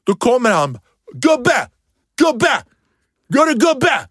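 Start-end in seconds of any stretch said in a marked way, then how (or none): 1.1-4.0 high, loud calling-out taunt; pitched-up jeering call across the park; taunting shout. Retold or imitated. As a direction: yell after him like a bratty kid. Kid taunting him from behind.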